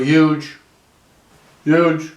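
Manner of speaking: a lightly voiced Trump saying it twice; Trump mini impression. Shown as a demonstration. Comic's mini Trump impression for the crowd. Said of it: "That's the mini impression".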